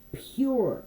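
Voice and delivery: ordinary talk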